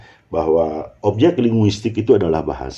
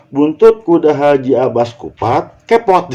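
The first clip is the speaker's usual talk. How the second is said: "Buntut kuda haji abas kupat" as one louder run; pause before kepot; 6 semitones higher, 4 dB louder, slower than usual. Shown as a demonstration. Teacher showing an impossible grouping, "kepot" split off. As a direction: hold back "kepot", then drop it alone on a high pitch. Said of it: "Tidak mungkin kan?".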